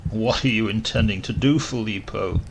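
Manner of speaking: usual speaking voice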